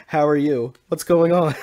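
loud cheery greeting. Acted. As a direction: call it out on the edge of laughter. Amused after abandoning the impression.

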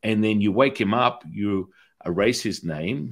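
ordinary talk

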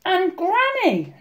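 put-on high, slow little girl; hesitant, drawn-out address; said carefully. A narrator's reading. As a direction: tread carefully, pitched high. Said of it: "Little Red said carefully".

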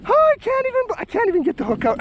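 high, breathless-with-joy babble; too thrilled to get through the task; overwhelmed excitement. Real, not acted. Angler fumbling with the hook on a trophy catch, excited. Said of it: "you can tell my excitement".

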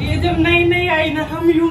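blurting it out loud with excitement; loud and eager, all in one rush; excited loud telling. Real, not acted. Sister loudly recalls playing with the new kitchen gadget all day, excitedly.

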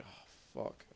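under-breath whispered swear; whispered curse. Retold or imitated. Quoting own in-game dismay.

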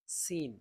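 সিন said with a sharp hissing s like a sparrow chirp; sparrow-like sin. Shown as a demonstration. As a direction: make সিন whistle like a sparrow. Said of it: "চড়ুই পাখির আওয়াজের মতো". (চড়ুই পাখির মতো সিন)

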